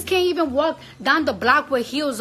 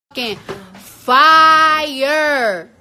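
louder, stressing fire; complaining to viewers her stomach burns, loud; emphatic complaint; 2 semitones higher, 8 dB louder, much slower than usual. Real, not acted. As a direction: complain loudly about the burning.

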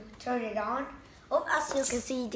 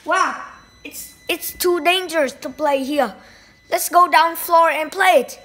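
raised voice, real alarm and thrill; startled excitement; 4 semitones higher, 10 dB louder than usual. Real, not acted. Vlogger warns that it's too dangerous here, loud and startled. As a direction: call it out loudly over the flying toy.

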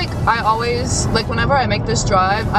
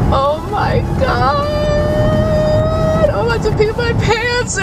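desperate need to pee; 12 semitones higher, slower than usual. Real, not acted. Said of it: "I have to pee so bad".

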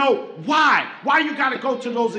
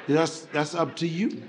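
doctor deadpan; 10 semitones lower, 7 dB quieter than usual. Retold or imitated. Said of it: "doctor's reply".

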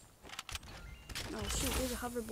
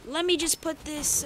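usual speaking voice

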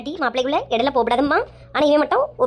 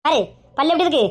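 usual speaking voice

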